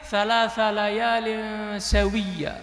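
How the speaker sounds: slow, measured verse recitation; slow Quran recitation. Acted. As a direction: chant it slowly, softly. Woman answers in Quran verse.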